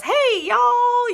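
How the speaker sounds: silly, loud high-pitched holler of greeting; hammed-up, exuberant high call; Shelley Swearingen. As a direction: mimic a big goofy hello, drawn out high. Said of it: "her style is".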